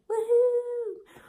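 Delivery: loud, high-pitched cheer; big high "woohoo"; celebratory woohoo. Real, not acted. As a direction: whoop in the new year. Cheering the new year.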